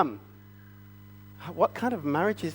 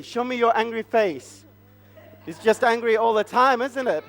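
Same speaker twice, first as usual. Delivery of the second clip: ordinary talk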